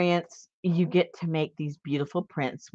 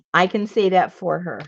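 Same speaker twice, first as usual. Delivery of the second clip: ordinary talk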